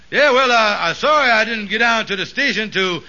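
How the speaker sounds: normal talking voice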